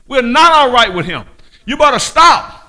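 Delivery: high, loud, urgent warning. Real, not acted. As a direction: shout the warning at the flock. Preacher warns loudly.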